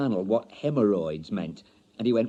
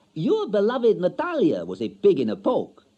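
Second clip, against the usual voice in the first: high, loud, cutting voice; wife taunting her husband; cold foreign wife; 8 semitones higher, 6 dB louder than usual. A narrator's reading. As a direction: deliver the sting proudly. Wife's cutting revelation.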